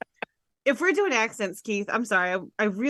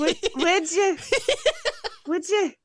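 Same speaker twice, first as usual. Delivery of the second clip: higher-pitched Scottish warm-up; Scottish accent; 9 semitones higher than usual. Acted. Host getting into the accent, high Scottish voice. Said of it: "how I have to get into Scottish".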